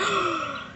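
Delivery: a sharp high gasp; shocked gasp. Retold or imitated. Daughter realising what dad meant, shocked gasp. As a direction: gasp as if scandalised.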